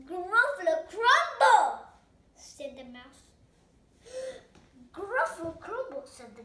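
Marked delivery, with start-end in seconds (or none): 0.1-1.9 high, loud and slow, drawn out; triumphant announcement. Acted. Mouse scares the gruffalo. 4.0-4.9 sharp high gasp of alarm; frightened gasp. Acted. Gruffalo is frightened. 4.9-6.0 higher, louder, quick alarmed repeat; alarmed echo. Acted.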